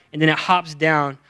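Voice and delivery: ordinary delivery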